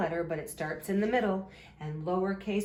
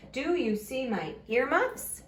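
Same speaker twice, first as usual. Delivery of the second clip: higher question lifting on EARMUFFS; question rise on EARMUFFS; 5 semitones higher than usual. Shown as a demonstration. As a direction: ask it with the voice up at EARMUFFS. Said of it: "your voice goes up at the end".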